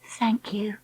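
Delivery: soft, tender thanks; gentle. Acted. Dakota kissing the turtle, gently thanking it. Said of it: "Dakota said gently".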